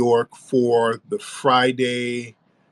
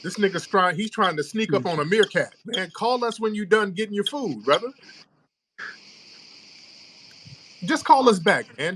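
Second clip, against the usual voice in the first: high mocking voice; 8 semitones higher than usual. Host mocking caller, raised voice.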